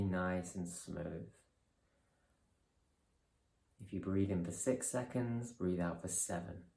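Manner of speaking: calm, measured guidance with long pauses; guided meditation. Real, not acted. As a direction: ease them through each breath, unhurried and softening. Leads a breathing meditation.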